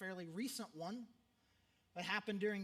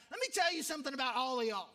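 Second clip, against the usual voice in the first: a loud, fast retold lecture; angry professor; 3 semitones higher, 7 dB louder, faster than usual. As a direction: snap it at the whole class like an angry old teacher. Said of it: "this dude, he's angry".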